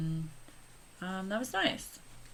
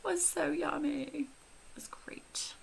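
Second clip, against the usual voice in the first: breathy whisper of delight; 10 semitones higher, 4 dB quieter than usual. Real, not acted. Vlogger whispering to viewers about the food, hushed delight. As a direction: hush it like a secret treat.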